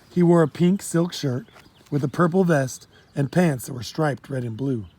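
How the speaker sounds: plain reading voice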